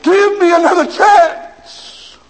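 high, loud drawn-out wail; slow wailing cry. Acted. Dying man wailing his last plea. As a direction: stretch the final plea. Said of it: "went into eternity crying".

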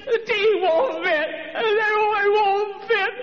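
put-on high, slow, sing-song old woman's lament; high drawn-out sing-song plea; old lady's wail. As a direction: wail it like a distraught old lady. Old lady Mary pleading, sung.

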